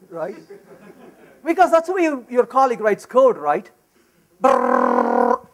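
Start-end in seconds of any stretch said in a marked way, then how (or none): 4.4-5.5 a shuddering, far lower brrr of disgust; brr, like a cold chill; shiver brrr. Acted. Shudder at a horrid long method.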